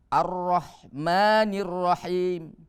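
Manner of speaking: very slow recitation, long held final syllable; Quran recitation demo. Shown as a demonstration. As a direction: draw out the long vowels evenly. (bacaan tartil, mad dipanjangkan)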